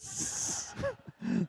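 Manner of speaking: high, breathy, quiet laugh; stifled giggle. Real, not acted. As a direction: laugh softly at your own joke. Speaker laughing at own joke.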